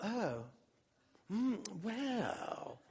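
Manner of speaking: soft, drawn-out murmurs of wonder; hushed imitation of an absorbed listener; rapt listening. Stranger takes in the gospel, softly marvelling. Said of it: "he's just seemingly eating it up".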